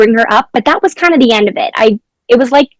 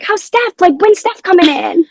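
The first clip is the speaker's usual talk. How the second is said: put-on eager kids; 8 semitones higher than usual. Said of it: "They would be like".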